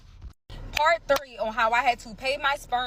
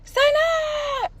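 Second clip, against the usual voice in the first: high, loud call across the street; desperate call to her child; yelling her name; 4 dB louder than usual. Retold or imitated. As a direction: shout the daughter's name from afar. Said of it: "I yell her name".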